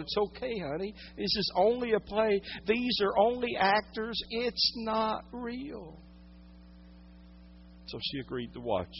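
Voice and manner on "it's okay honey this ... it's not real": high, soft motherly soothing; reassuring mother. Retold or imitated. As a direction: soothe a frightened child, drawing out the end. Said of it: "tried to rationalize with her little four-year-old".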